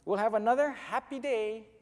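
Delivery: put-on high, loud, cheery child; little girl calling. A narrator's reading. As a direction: call out happily in a small girl's voice. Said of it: "she called".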